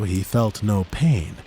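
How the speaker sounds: ordinary delivery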